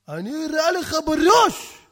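slow, high, loud threat; menacing guard at full volume; shouted threat. Acted. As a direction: draw out the threat and yell it.

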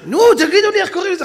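high, loud, fast demand; impatient demand. Retold or imitated. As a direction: urge them to say the word. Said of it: "אז אחרי זה הוא אומר". (דרישה חסרת סבלנות, מהירה וגבוהה)

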